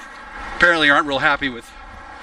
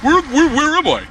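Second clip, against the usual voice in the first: high, lost and bewildered; confused ex-inmate voice; 12 semitones higher than usual. Retold or imitated. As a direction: play a dazed released inmate. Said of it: "walking around wondering".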